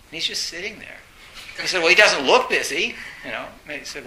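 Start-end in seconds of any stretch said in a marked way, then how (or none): 1.8-2.9 loud, high indignant retort; arguing with the secretary. Retold or imitated. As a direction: push back at the secretary.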